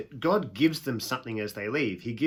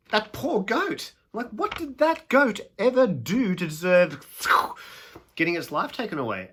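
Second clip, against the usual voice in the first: high, loud cry of dismay; shocked pity; 9 semitones higher, 6 dB louder than usual. Retold or imitated. Student protesting the goat's death, high and appalled. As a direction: gasp out the outrage for the goat. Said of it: "and you're like".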